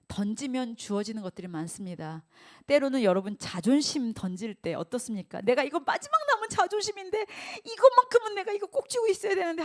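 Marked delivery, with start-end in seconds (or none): 5.4-9.6 high, loud pleading mock voice; 집착하는 흉내. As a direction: whine like someone clinging to pride. 자존심을 붙잡는 사람 흉내. (자존심을 놓지 못하는 사람을 높은 목소리로 흉내)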